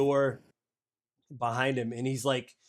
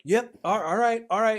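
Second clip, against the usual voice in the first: higher, flustered yes-sir voice; nervous young soldier; 8 semitones higher, 4 dB louder than usual. Acted. Hax flustered before his commanding officer, higher nervous voice. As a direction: stammer it out eager to leave.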